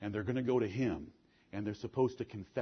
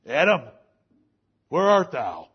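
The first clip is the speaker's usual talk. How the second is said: loud, high summons across the garden; God calling out; 4 semitones higher, 13 dB louder than usual. Acted. God calling for Adam hiding in the trees, loud summons. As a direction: call him out of hiding.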